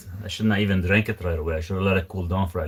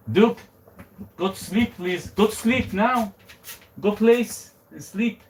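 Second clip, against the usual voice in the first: high, slow sing-song pet voice; talking to dog; 11 semitones higher, slower than usual. Real, not acted. Coaxing dog to sleep.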